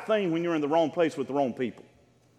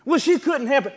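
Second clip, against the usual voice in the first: higher, louder imitation of an excuser; mock excuse-making; 7 semitones higher, 5 dB louder than usual. People excusing a sinner's behaviour, mock protest. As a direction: imitate someone protesting on another's behalf.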